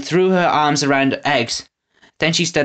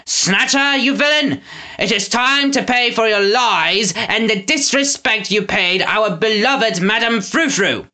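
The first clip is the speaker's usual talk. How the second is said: thunderous lord; 8 semitones higher than usual. A narrator's reading. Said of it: "thunderous voice".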